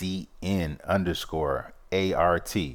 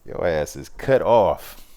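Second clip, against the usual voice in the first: mock sassy, high swooping ooh; a playful diva brushing someone off; sassy cut-off; 10 semitones higher, much slower than usual. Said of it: "And I'm like".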